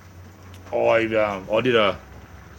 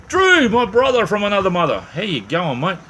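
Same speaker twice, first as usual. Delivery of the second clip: excited greeting; 10 semitones higher, 7 dB louder than usual. Real, not acted. Loud greeting to a friend in chat.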